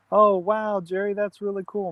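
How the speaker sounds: ordinary delivery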